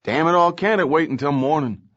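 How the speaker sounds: exasperated outburst. Acted. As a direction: blow up, then plead to be left alone. Woken lieutenant protests late call.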